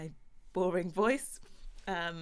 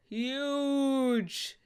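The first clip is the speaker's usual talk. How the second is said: huge stretched long and loud; huge blown up big, higher and louder; stress on huge; 6 semitones higher, 6 dB louder than usual. Real, not acted. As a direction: hit huge hard, drawing out the vowel. Repeats huge to exaggerate her fandom.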